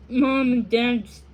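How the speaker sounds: usual speaking voice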